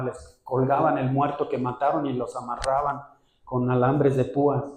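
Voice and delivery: ordinary talk, nothing marked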